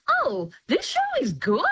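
high-pitched, laughing realisation; oh, pleasantly won over, voice lifting; pleasant surprise. Retold or imitated. Sceptical critic retelling being won over by the show, pleasantly surprised. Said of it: "first episode, I'm like".

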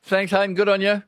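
high, loud, beaming thanks; radio host loudly thanking a caller; cheery sign-off. Real, not acted. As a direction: send the caller off with gusto.

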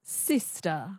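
SISTER with a schwa for the final R, soft; dropped R. Shown as a demonstration. Student echoing coach, sister without R. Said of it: "you drop the R and you make it a schwa".

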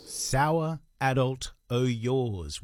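slow, dry, no reverb; slow plain repeat. Shown as a demonstration. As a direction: spell it out slowly for listeners. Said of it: "I'll play it again for you".

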